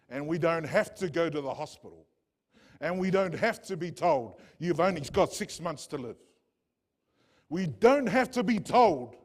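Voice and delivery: rising, shouted preacher's cry; fiery preaching. Acted. Preacher to his congregation, crying out loud and high. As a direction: lift the congregation with a loud, ringing promise.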